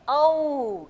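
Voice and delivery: औ stretched into a long "au" glide; slow teacher's model of औ; demonstrated pronunciation. Shown as a demonstration. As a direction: draw औ out, sliding from "a" to "u". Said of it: "ऐसे बोलेंगे".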